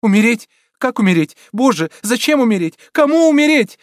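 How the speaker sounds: high, loud, escalating questions; distraught, panicked outcry; frantic. A narrator's reading. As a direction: cry out wildly, climbing to a peak. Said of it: "говорила обезумевшая девушка".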